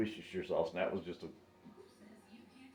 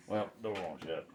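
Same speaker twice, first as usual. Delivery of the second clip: ordinary delivery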